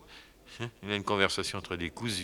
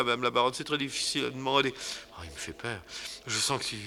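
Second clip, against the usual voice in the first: ordinary delivery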